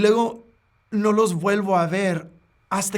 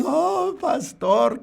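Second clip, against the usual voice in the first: high, slow, drawn-out whining plea; mock whimper; 9 semitones higher, much slower than usual. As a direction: imitate someone coming back crying, much higher. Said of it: "Y vienen llorando otra vez". (lamento fingido, agudo y lento)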